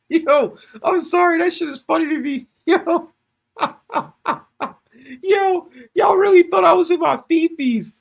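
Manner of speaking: high, loud laughing; cracking up at the chat's worry; laughing fit. Real, not acted. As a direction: crack up while talking. Can't stop laughing.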